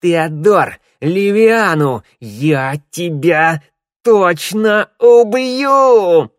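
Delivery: high, loud, very slow furious yell; screaming. Acted. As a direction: scream the threat, drawn out. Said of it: "вопя на всю усыпальницу".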